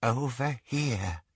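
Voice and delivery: soft, slow hushed voice; whispered. A narrator's reading. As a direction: breathe it quietly. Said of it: "she whispered".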